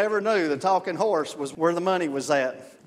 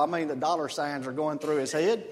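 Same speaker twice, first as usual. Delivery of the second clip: usual speaking voice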